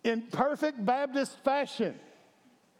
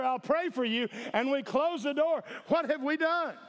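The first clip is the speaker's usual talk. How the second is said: rising preacher's call, high and driving; full-force sermon delivery; fiery preaching; 4 semitones higher than usual. Acted. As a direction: preach it to the congregation.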